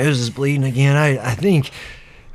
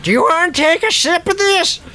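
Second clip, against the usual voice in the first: put-on high-pitched old-timer voice; old eccentric Ernie; 14 semitones higher than usual. Ernie offering the injured woman a sip, high and eager. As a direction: offer help in a high character voice.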